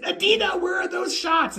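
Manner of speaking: high mock outcry; retold exasperated demand. Fan demands the makeup shots from photographer, high mock outcry. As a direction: reenact a high, urgent protest.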